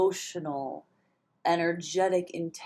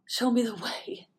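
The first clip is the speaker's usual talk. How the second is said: whispered plea; 6 semitones higher than usual. Real, not acted. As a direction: whisper "Show me the way" as a prayer. Pleading prayer aloud.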